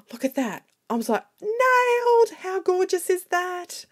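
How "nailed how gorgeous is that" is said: high, loud, thrilled exclamation; squealing with delight, then slower; super excited. Retold or imitated. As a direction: crow over the perfect stamp. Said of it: "I was, like, so super, super excited".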